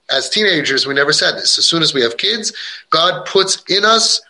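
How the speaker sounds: ordinary talk, nothing marked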